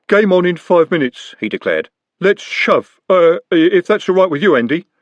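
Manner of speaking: matter-of-fact menace; a lightly voiced street thief; Jumbo's voice. Jumbo deferring to Andy.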